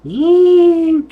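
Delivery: high buzzing engine noise; a mouth-made motorcycle drone. Retold or imitated. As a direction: buzz like a bike speeding off.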